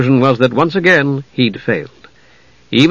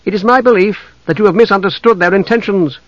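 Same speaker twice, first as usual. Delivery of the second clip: higher-pitched formal reasoning; diplomatic half-elf; 7 semitones higher than usual. Acted. Envoy defends two captives to elves, formal and firm. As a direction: reason firmly with them.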